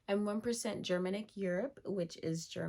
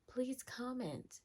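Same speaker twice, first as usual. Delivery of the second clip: slow, softer whispered plea; pleading whisper; 4 semitones higher, 4 dB quieter than usual. Acted. Begging her viewers to comment, in a slow pleading whisper. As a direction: whisper it like a desperate little beg.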